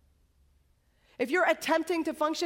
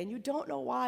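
ordinary delivery

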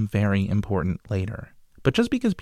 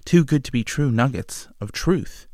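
ordinary talk

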